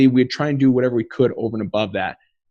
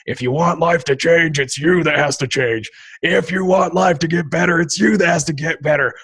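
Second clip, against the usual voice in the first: Tony Robbins pep talk; 5 semitones higher than usual. Retold or imitated. Recalling a motivational tape on the drive home, lightly mock preachy. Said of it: "he's like".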